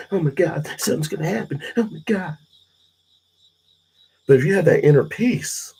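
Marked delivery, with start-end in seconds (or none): 0.1-2.4 mock anxious fretting. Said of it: "you're always".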